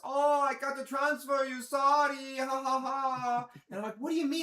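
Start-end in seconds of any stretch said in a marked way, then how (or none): 0.0-3.5 slow, higher, louder mock agent with fake laugh; mock call center guy. Mocking call center guy's excuse. 3.9-4.4 higher, louder exasperated what do you mean; indignant retold question. Retold self talking to agent.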